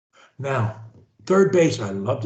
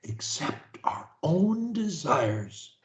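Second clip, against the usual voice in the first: very slow, each word spaced for repeating; slow dictation; 7 dB quieter, slower than usual. Shown as a demonstration. Coach leading a client in repetition, very slow. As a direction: say it slowly for them to copy. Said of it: "say after me".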